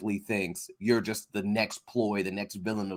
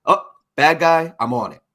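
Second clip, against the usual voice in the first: eager hero quote; 6 semitones higher, 8 dB louder than usual. Retold or imitated. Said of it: "she's just kinda".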